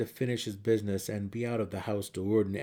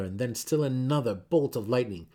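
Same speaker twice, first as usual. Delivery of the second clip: usual speaking voice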